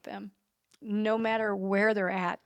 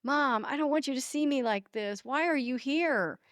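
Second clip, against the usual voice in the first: imitating son's upset plea, pitched up; retold ashamed anger; 6 semitones higher than usual. Mother imitates son found using drugs, upset higher-pitched plea. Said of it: "he would be ashamed and embarrassed and angry".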